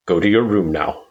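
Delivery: usual speaking voice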